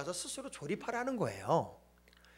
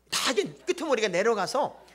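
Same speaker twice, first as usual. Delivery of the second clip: ordinary talk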